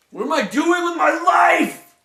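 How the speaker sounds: high, anguished outcry; crying out at a wasted life; despairing cry. Acted. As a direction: wail it to the heavens. Despairing outburst.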